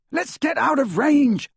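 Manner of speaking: high-pitched urgent yell; strained battlefield holler. A narrator's reading. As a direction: call out in alarm, pitch way up. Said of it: "yelled Ron".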